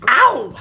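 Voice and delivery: sudden high yelp of hype; loud yelp. Real, not acted. Sign-off yelp before catchphrase.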